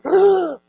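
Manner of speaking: a high, loud mock gasp. Shown as a demonstration. Pastor demonstrating the gasp the congregation should have made. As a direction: show the gasp nobody gave. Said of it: "here's why none of you are going".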